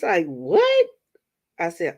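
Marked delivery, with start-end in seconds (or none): 0.2-0.9 much higher, louder startled squeak; high startled what; shocked what. Retold or imitated. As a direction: react in disbelief. Shocked reaction retold.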